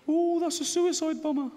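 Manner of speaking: high, jumpy frightened inner voice; panicky inner voice. Retold or imitated. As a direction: blurt it like the scared half of your brain. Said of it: "this one goes".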